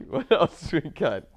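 ordinary talk, nothing marked

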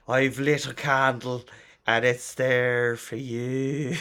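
slow, slurry put-on Irish accent; slurry deep Irish. Shown as a demonstration. Obliging a friend who wants male Irish, slurry deep Irish accent. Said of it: "slurry, kind of deep Irish".

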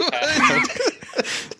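words broken by giggles; hiccup giggles. Real, not acted. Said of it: "Stop making me laugh".

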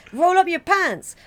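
high, loud, drawn-out order; host giving orders. Retold or imitated. Host making a visitor obey, high loud order. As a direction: play the host demanding it, slow and high. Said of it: "they say".

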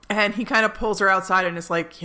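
ordinary delivery

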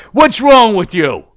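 loud, high, drawn-out mock yell; angry dad. Imitating dad confronting the child he startled, angry yell. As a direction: imitate an indignant father demanding an answer.

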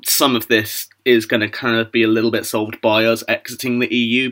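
normal talking voice

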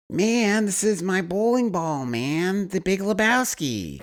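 drawling stoned dude, high and loud; lazy surfer-dude drawl; Jeff Bridges impression. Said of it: "Jeff Bridges is like".

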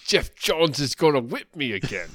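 a put-upon Cockney complaint; mock Cockney accent. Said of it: "if he talks like that".

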